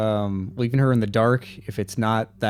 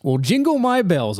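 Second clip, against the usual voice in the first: excited exclamation; 8 semitones higher than usual. Acted. In-character exclamation.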